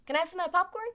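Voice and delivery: little rabbit voice, rising high; pixie. Acted. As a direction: play a cheeky rabbit begging. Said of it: "rabbit asking for popcorn".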